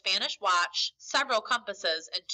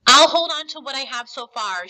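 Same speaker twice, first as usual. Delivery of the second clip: mildly exclaimed, higher and louder; Jim's mother exclaiming, raised voice; 4 semitones higher, 11 dB louder than usual. A narrator's reading. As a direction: read as a firm exclamation. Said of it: "she exclaimed".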